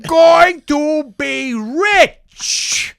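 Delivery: very high, slow, shouted overacting; hammed-up bad acting. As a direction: overact like the cast. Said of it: "You're like".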